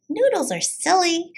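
put-on high, silly little girl. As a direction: giggly kid sister voice. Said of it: "said Jezebel".

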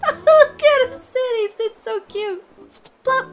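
very high, cooing squeal; giddy high-pitched adoration; gushing delight. Real, not acted. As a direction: coo over something unbearably cute. Gushing over the sitting animation.

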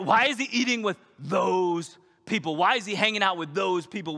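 disdainful, rising scorn at those people; indignant Pharisee. Retold or imitated. Pastor voices a Pharisee appalled at Jesus's company, loud and sneering.

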